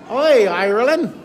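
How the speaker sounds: a hammed-up Irish greeting, lightly done; Irish accent. Greeting Irish viewers in a mock Irish accent. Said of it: "That was me trying to sound Irish".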